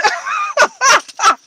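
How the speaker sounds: bright amused cackle; high laugh. Real, not acted. Laughs at own story.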